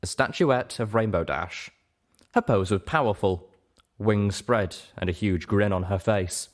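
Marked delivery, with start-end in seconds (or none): none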